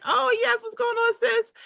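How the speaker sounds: squealing high, delighted hello; excited high greeting. Retold or imitated. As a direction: greet her like a long-lost sister, way up high. Greeting a new contact excitedly.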